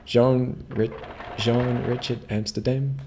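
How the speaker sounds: a weak try at a Dutch accent; half-hearted Dutch accent on the name; bad Dutch accent. Shown as a demonstration. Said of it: "that's a fucking shite impression".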